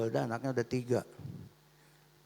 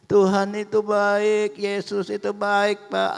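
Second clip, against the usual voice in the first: lantang dan tinggi, kata-kata diulur; seruan penuh syukur; 5 semitones higher, 6 dB louder, slower than usual. Acted. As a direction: bersaksi kepada suami dengan suara keras, lambat. Ibu bersukacita meyakinkan suaminya. (berseru penuh syukur)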